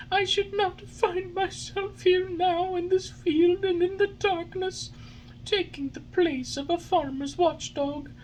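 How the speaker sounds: put-on high, sorrowful puppet boy lamenting; weak Pinocchio. A narrator's reading. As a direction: regret everything in a small voice. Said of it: "cried out in a weak voice".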